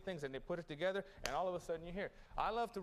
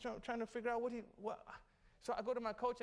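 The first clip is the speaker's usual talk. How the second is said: usual speaking voice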